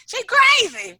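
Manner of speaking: high, loud mock alarm; imitated disbelief. Onlookers reacting to someone cold, mock alarmed. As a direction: exclaim it high and drawn out. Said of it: "They be looking at me like".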